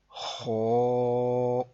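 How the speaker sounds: خو with raspy kh and held rounded heavy vowel; full, thick letter. Shown as a demonstration. Teacher models a heavy letter, thick drawn-out خو. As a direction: fill the mouth on خو and sustain it. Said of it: "موٹی آواز میں پڑھا ہے".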